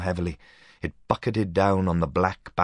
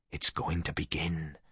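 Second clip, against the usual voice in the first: hushed; 6 dB quieter than usual. Acted. Boys spooked at window.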